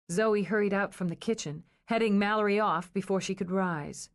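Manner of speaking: ordinary delivery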